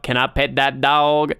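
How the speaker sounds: low, odd monotone mimicry; dead-eyed coworker imitation. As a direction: imitate a coworker staring you dead in the eyes. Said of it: "stared me dead in the eyes and started going".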